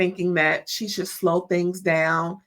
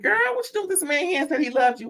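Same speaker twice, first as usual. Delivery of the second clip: put-on higher and fast, sassy girl-talk; sassy disbelief; 9 semitones higher, much faster than usual. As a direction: scold her friend in rapid disbelief. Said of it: "Even I was like".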